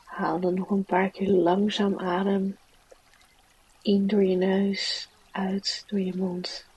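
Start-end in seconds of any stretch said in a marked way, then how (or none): 3.8-6.7 gentle whispered breathing cue; soft spa whisper. Acted. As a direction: whisper it softly, like a spa guide.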